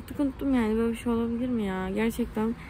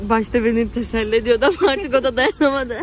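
nervous laugh; 8 dB louder than usual. Real, not acted. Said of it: "sinirden gülüyorum".

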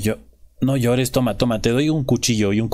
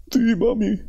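put-on childish reply; 8 semitones higher than usual. Playing the son answering mom.

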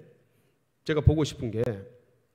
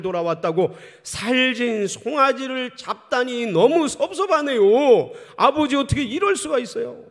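higher, louder rising grievance; aggrieved elder son; 7 semitones higher, 6 dB louder than usual. Acted. Elder son protests to father about the fatted calf, building hurt and loud. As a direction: complain to your father, building to hurt outrage.

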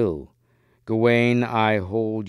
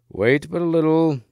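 higher, booming call from a hollow; mighty voice; 7 semitones higher, 4 dB louder than usual. A narrator's reading. Hidden knight answers from beneath the mound, mighty voice. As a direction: boom it out to someone above. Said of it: "came a mighty voice out of the hollow".

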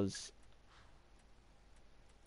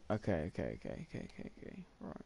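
muttering to self; 5 semitones lower, 12 dB quieter, much faster than usual. Real, not acted. Talking himself down.

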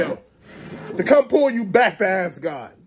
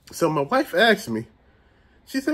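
ordinary talk

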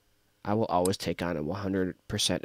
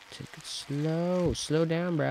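"Take it slow" drawn out slowly, higher, every word stretched; 9 semitones higher, slower than usual. Shown as a demonstration.

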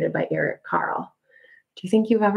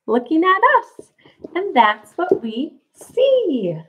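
slow sing-song, rising higher at end; reading to a toddler; 5 semitones higher, slower than usual. Acted. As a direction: draw it out like a bedtime book.

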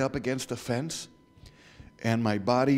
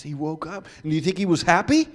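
usual speaking voice

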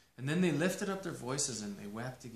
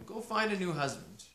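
ordinary delivery, nothing marked

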